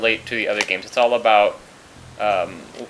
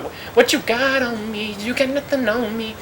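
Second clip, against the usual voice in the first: cocky taunt; 10 semitones higher than usual. Pretend. Taunting enemy after jump.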